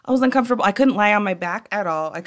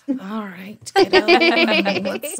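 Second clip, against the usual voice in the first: mock sing-song parent talk; parent to kiddo; 3 semitones higher, 5 dB louder, much slower than usual. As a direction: coax a little one, playfully acted. Voicing parent to kid.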